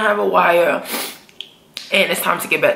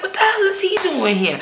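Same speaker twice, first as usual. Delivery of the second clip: high, shocked question; stunned what-the-hell; 11 semitones higher than usual. Retold or imitated. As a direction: ask it in disbelief, way up high. Viewer's shocked reaction.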